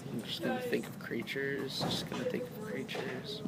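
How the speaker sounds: soft, half-to-self chant; stalling murmur. Real, not acted. Game master thinking up monsters for the players, stalling murmur. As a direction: murmur it softly to buy time.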